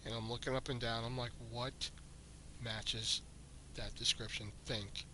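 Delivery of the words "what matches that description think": muttering to himself. Retold or imitated.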